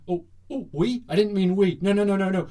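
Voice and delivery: high, loud panicked denial, hammed up; mock flustered backtracking. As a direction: backpedal in comic panic after a slip. Acting Russia caught in slip.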